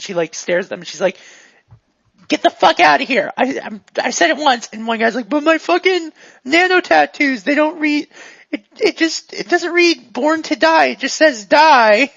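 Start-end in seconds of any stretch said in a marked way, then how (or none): 2.3-4.7 raised, higher voice, laying down the order; angry shout. Acted. Armed owner repeats her order. 5.3-12.1 high-pitched, aggrieved customer protest; hammed-up whiny outrage at being thrown out; loud complaining. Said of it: "complaining rather loudly".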